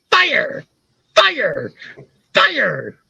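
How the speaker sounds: high, falling cartoon exclamations; Beavis. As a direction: chant it in a mock cartoon idiot voice. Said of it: "started talking like Beavis".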